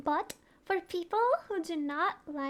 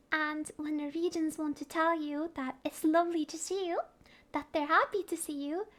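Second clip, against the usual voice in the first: usual speaking voice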